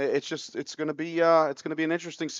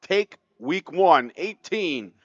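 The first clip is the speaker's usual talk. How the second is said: broadcast sign-off; 4 semitones higher, 5 dB louder than usual. Acted. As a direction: announce the final score grandly. Announces the final score, signs off.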